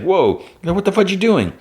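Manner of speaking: squeaky startled outburst; high shocked voice. Retold or imitated. Shocked character quote.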